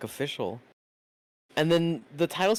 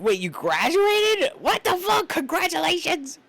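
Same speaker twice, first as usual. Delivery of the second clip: shocked shout; 13 semitones higher, 4 dB louder, slower than usual. Real, not acted. Streamer reacts excitedly.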